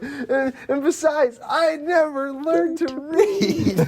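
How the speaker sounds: high, wailing mock sobs, dragging at the end; hammed-up crying. Retelling an Oscar-reel breakdown, hammed-up tearful wail. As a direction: overplay a tearful Oscar-bait confession. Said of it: "it's, like, Oscar reel or whatever".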